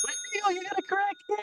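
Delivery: excited squeal. Real, not acted. Quizmaster squealing with delight that Rigo finally answered correctly. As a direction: squeak the good news out high. Announcing Rigo got it right.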